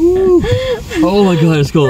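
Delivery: high whooping shout at the cold; excited cold shout. Real, not acted. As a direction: whoop out at the freezing wind. Stepping out into cold wind.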